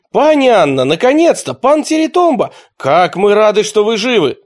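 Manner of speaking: high, loud fawning shout; loud cries of welcome. A narrator's reading. The traitor lords hailing Luka, loud and fawning. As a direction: shout out your delight. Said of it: "громко закричали".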